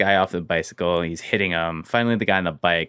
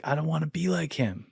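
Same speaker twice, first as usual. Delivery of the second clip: mild crying; 7 semitones higher, 9 dB quieter than usual. Retold or imitated. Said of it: "And he just started crying".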